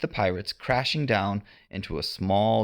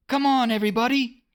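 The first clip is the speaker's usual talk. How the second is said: high, fast rallying yell; rally the boys quickly; a boy urging his friends on; yelled call; 4 dB louder, faster than usual. A narrator's reading. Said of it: "he yelled".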